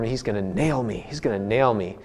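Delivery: a lightly voiced fear of getting a ticket; worried inner thought. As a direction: mutter it like you know you're done for. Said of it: "I thought for sure".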